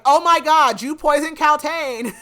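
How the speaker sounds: very loud, high hammed-up gasping outrage; mock horror, projected for everyone to hear; feigned shock. Storyteller voicing the Duke playing innocent, loud feigned shock. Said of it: "he acts like he had nothing to do with it".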